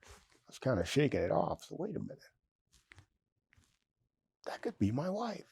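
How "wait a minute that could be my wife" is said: hushed inner thought. Retold or imitated. Inner thought on seeing her.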